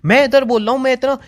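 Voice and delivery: high, hurt and heated pleading argument; heated hurt protest. Real, not acted. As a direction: argue back, wounded and worked up. Heated argument with leaving girlfriend.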